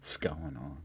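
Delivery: whispered practice of the phrase; hushed, breath-only repeat; whispered try. Shown as a demonstration. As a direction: whisper it to yourself, testing it out. Trying out the phrase under breath.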